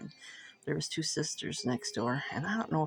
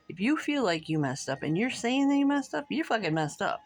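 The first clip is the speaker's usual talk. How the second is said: higher and louder, an indignant snap back; angry retort; 6 semitones higher, 4 dB louder than usual. Retold or imitated. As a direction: throw her words back at her, hot.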